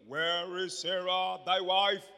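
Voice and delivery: slow, drawn-out loud question; solemn, stretched biblical questioning; declaimed scripture. Acted. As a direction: intone it grandly to the congregation.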